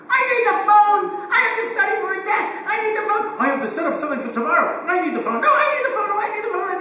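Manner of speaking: high, loud squabbling yells; screaming kids. Retold or imitated. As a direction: kids fighting over the phone, shouting over each other. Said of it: "a house of screaming maniacs".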